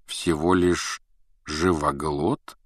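"Всего лишь живоглот?" slowed, with "живоглот" rising at the end; second thoughts, questioning "живоглот"; doubting echo question. A narrator's reading. Same words repeated as a question.

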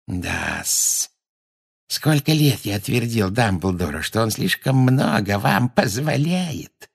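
slow, gloating grumble with a sneer; nasty caretaker. A narrator's reading. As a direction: relish the complaint, drawing it out. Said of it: "с противным смешком сказал Филч". (противный смотритель, злорадно и медленно)

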